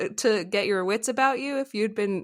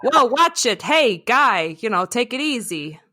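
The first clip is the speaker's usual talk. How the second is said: New York raccoon calling a truce; New York accent; 4 semitones higher, 4 dB louder than usual. Acted. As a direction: talk the other parent down in a New York accent. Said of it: "The raccoon has a New York accent".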